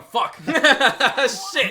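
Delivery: loud, high mock cursing through laughter; hammed-up cop swearing; panicking cop. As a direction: act out the officer's dismay, cracking up. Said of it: "Yo, le policier est comme".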